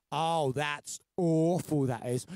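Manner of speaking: slow, drawn-out mock dismay; sarcastic. Shown as a demonstration. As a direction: drag it out with heavy sarcasm. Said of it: "sarcasm can be mis-, uh, misunderstood".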